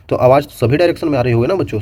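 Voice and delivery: ordinary delivery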